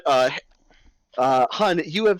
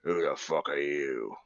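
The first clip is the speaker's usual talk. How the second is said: giant's challenge; 10 dB quieter than usual. Acted. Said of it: "looking down at you like".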